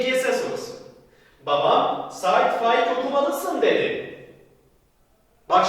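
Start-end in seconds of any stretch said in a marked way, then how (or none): 1.5-4.2 slow reading. Shown as a demonstration. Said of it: "reads example sentence slowly".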